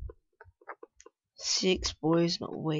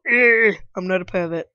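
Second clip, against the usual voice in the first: ordinary delivery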